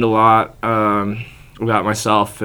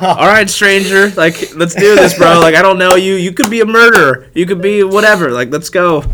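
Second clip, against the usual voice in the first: reckless excitement; 7 semitones higher, 8 dB louder than usual. Retold or imitated. Agreeing to drop acid with a stranger, hyped and loud. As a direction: throw caution away, half yelling it.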